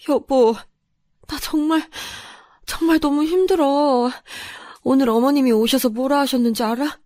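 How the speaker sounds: high, weepy wife; acted sobbing, struggling to get words out; tearful complaint. A narrator's reading. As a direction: pour out your misery to your husband. Wife breaks down to husband.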